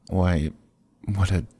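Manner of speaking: ordinary talk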